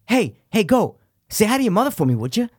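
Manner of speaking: high, pushed Wahlberg voice; mock Wahlberg teasing his friend; [name] impression. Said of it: "give me your best [name] impression".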